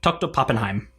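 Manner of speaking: crisp German pronunciation of the title and name; proper German accent. Shown as a demonstration. Offers to say the professor's name properly, German accent. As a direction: say it as a native German would. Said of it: "in a proper German accent".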